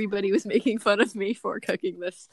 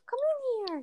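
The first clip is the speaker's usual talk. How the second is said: calling the dog; 13 semitones higher, 7 dB quieter than usual. Real, not acted. Calling dog inside sweetly.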